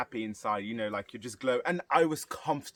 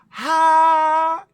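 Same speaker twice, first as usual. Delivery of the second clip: loud, high, drawn-out "ha"; huge theatrical gasp; 14 dB louder than usual. Retold or imitated. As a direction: burst out in exaggerated delight. Teacher's loud birthday gasp.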